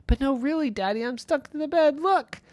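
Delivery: put-on high child voice begging; pleading boy. Irwin pleading with his daddy, high insistent boy voice. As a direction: make daddy believe you.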